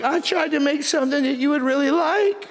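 high-pitched imitation of a tearful complaint; mock bawling. Husband retelling wife's hurt over her chicken, mock bawling. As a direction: whine it high like a mock sob. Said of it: "she's bawling like".

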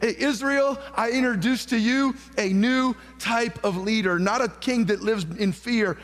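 high ringing herald's call; proclaiming David. Retold or imitated. David introducing himself to Israel, ringing proclamation. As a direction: announce him to the nation. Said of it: "He steps out and says".

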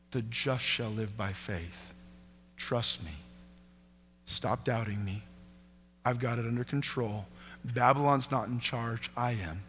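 calm them, nearly whispering; hushed reassurance. Acted. God reassuring the doubter, soft and low.

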